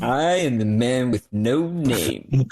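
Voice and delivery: slow, hammed-up mystery figure; lightly put-on dramatic introduction, drawn out; mock ninja. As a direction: intone it like a mysterious stranger, for laughs. Joking impression of the ninja's line.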